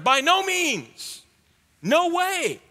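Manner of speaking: high, slow, emphatic outburst; Paul flipping out. Retold or imitated. Preacher voicing Paul flipping out at the question, high and emphatic. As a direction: cry out a fierce refusal. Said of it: "So he flips out".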